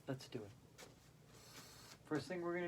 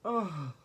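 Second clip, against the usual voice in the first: exercise laughter. Shown as a demonstration. Yoga teacher demonstrating laughter for the class, deliberate laughing. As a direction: burst out with an oh, then laugh it out. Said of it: "with laughter".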